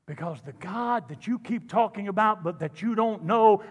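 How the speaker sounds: ordinary delivery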